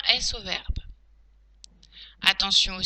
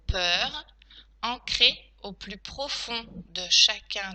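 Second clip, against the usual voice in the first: slow teacher dictating, punctuation named; careful, spaced-out phrases; dictation. Shown as a demonstration. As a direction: dictate it chunk by chunk.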